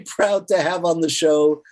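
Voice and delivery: normal talking voice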